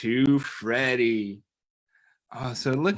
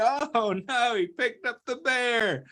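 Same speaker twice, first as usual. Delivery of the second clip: high-pitched mock alarm; acted surprise; 8 semitones higher than usual. Acting out Eddie's surprised face for children, high mock alarm. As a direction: play startled, voice jumping up high. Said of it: "He looks surprised. He's like".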